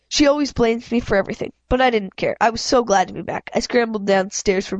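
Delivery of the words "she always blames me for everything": ordinary delivery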